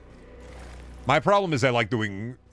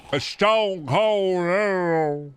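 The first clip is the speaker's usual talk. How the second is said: very slow, drawn-out promo line; hammed-up slow announcer line; slow wrestler voice; 7 semitones higher, 3 dB louder, much slower than usual. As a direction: stretch it like a ring announcer. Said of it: "the Stone Cold voice".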